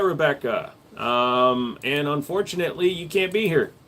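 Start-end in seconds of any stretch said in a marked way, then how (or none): none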